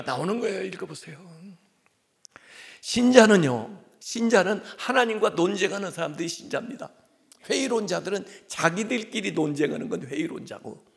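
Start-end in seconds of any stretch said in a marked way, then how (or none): 2.8-10.9 emphatic proclamation. Real, not acted. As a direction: drive the point home to the congregation. Preacher proclaims with force.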